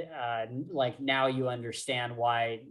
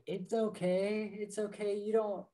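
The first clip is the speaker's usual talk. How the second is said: imitating gentle comfort; 8 semitones higher than usual. Retelling Ari comforting Quoth, high gentle imitation.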